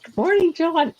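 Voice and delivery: high, bright-voiced hello; sing-song greeting. Real, not acted. Greeting John as he walks in, high sing-song. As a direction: chirp a cheery good morning at John.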